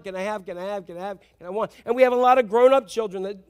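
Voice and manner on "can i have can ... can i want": put-on pestering child fading softer. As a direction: let the nagging trail off quietly. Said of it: "it's constant".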